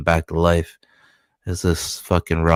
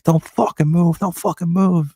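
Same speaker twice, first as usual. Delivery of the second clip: higher, put-on barking thug voice; henchman threat; 11 semitones higher than usual. Henchmen ordering hostages to freeze, higher put-on thug voice. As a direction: play a jumpy gunman. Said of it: "the henchmen are like".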